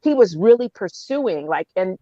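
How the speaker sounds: usual speaking voice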